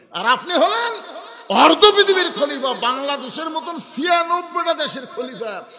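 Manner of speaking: loud, high rhetorical outburst; full-force preaching. Acted. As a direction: proclaim it at the top, easing on the last question.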